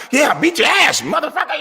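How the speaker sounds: high-pitched mock gloating yell; disrespectful gamer trash talk. Son gloating after winning a game, exaggerated high trash talk. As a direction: crow like an obnoxious winning gamer. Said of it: "they compete in a very disrespectful manner".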